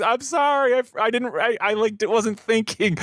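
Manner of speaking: high-pitched mock apology, on the edge of laughter; imitated laughing apology. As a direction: imitate someone apologising through giggles. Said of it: "She starts laughing".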